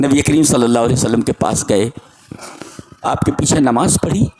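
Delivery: ordinary talk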